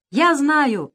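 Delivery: high, loud eager shout. A narrator's reading. Eager pirate shouts out his idea, high and loud. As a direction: blurt it out loud. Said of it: "закричал весельчак У".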